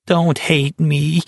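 high, slow pleading whine; a mild high whine, drawn out; whining. Acted. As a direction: stretch it out like a begging child. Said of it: "Barkovich was whining".

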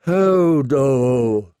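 long drawn-out mournful moan of a name; slow, mournful character voice. A narrator's reading. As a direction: draw the word out sorrowfully. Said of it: "said Hodor mournfully".

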